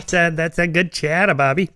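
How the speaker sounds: put-on joking Boston accent, dropped R's; mock Boston Brahmin accent; Kennedy. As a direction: poke fun with a New England politician's accent. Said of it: "when Kennedy was president, they used to make fun".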